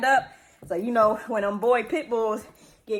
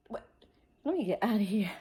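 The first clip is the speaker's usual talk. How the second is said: hushed whisper while slipping away; 4 dB quieter, faster than usual. Real, not acted. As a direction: whisper it so the dogs don't hear. Sneaking away from the dogs.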